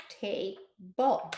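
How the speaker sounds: TABLE in two separate beats, ta... ble; finger-stretched syllables. Shown as a demonstration. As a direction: break TABLE apart with a gap after the first syllable. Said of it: "we'll finger stretch".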